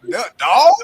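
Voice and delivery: Baltimore "Doug" for dog, high and loud; mock Baltimore accent. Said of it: "we say Doug".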